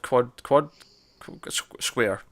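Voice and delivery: stumbling, halting search for the word; struggling for the word. Real, not acted. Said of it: "I was struggling there".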